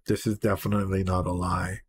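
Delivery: hushed loving words; tender confession. Acted. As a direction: murmur it like a secret. Reading tender lyric aloud.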